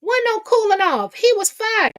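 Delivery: high, loud gloating reveal; gleeful exclaim. Real, not acted. Gossip host gloating to viewers about firing. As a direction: crow it out with delight.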